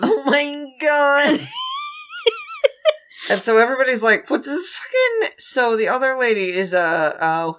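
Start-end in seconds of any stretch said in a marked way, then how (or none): 0.0-1.4 slow, high shocked cry. Real, not acted. Shocked reaction. 1.4-1.9 high squealing laugh; shrieking laugh. Real, not acted. 4.3-5.3 high shocked outburst. Retold or imitated. Crowd reaction quote.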